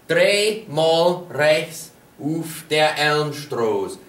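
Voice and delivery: slow each word out for the learner; slow, careful model sentence. Shown as a demonstration. Teacher models directions for learners, slow and clear. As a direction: spell it out slowly, like a teacher. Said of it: "For example".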